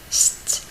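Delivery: "St-" whispered, isolating the consonant cluster; whispered sound demo. Shown as a demonstration. Teacher isolates the St sound for students in a whisper. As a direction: breathe out "St-" alone, no voice. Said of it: "il se lit comme stra".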